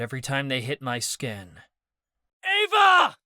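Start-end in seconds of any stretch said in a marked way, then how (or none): 2.4-3.2 high, loud desperate shout; shouting a name. A narrator's reading. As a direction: call out to her at full voice. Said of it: "I cried".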